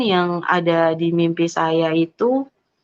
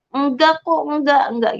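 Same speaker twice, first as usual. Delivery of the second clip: higher-pitched mock protest of denial; acted denial; 8 semitones higher than usual. Showing listeners the denial not given to parents, acted protest. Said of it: "tidak menyangkal kayak".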